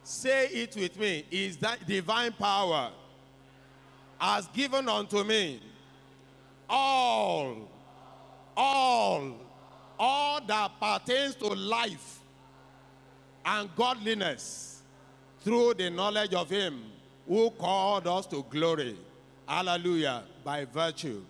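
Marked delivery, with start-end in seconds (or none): 0.0-5.6 preaching at full force, building louder. Acted. Preacher leads congregation in declaration. 6.7-9.3 each all louder and higher; emphatic repetition. Acted. Repeated word building intensity. 10.0-20.2 preaching at full force, building louder. Acted. Preacher leads congregation in declaration.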